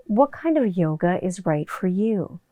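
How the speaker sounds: slow, soft promo read; lightly voiced announcer; a hint of a quiz-show read; ad voiceover. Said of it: "yelling into a microphone".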